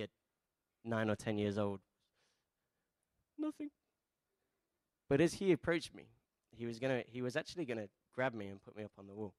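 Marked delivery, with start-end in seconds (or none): 3.4-3.7 tiny high-pitched mock kid voice; very high, timid little voice, put on; timid child. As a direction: squeak it like a scared little boy. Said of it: "And the timid, "Nothing" guy".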